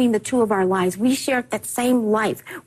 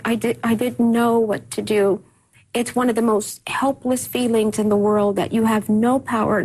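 ordinary delivery

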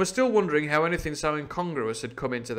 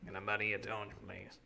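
a quiet pirate asking for the torch, much softer; 4 semitones lower, 14 dB quieter than usual. Acted. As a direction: mumble the request, softly.

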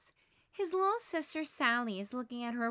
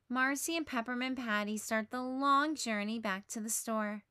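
usual speaking voice

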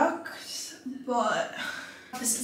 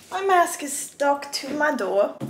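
playful put-on British accent, higher pitched; 4 semitones higher than usual. Vlogger showing viewers her stuck mask, mock British accent. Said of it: "I don't know why I'm talking British".

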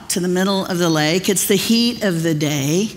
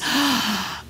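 sudden gasp; 3 semitones higher, 5 dB quieter than usual. Retold or imitated. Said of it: "Pete realizes".

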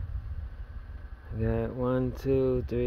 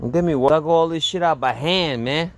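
louder, higher half-joking protest; mock-indignant exclamation about the hard work; jokey outburst; 6 semitones higher, 6 dB louder than usual. Real, not acted. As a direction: blurt it out as a playful complaint. Joking protest about digging by hand.

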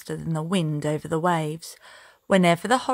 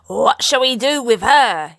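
a sly, smug pirate sneer; mock him with a smirk, a touch louder; 4 semitones higher, 6 dB louder than usual. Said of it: "he said with a smirk".

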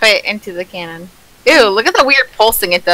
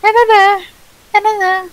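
high singsong; 10 semitones higher than usual. Pretend.

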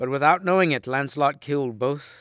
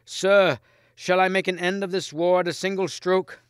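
high, loud eager call over the fallen king; crying out; 9 semitones higher, 5 dB louder than usual. A narrator's reading. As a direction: shout the question across the battle. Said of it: "crying to Lancelot".

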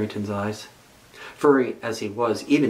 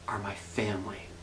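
softer whispered thought; 3 semitones lower, 10 dB quieter than usual. A narrator's reading. Bunny realizing he has a family, whispered. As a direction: whisper it to yourself in wonder.

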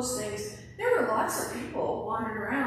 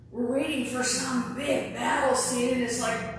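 usual speaking voice

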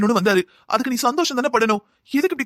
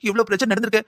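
ordinary delivery

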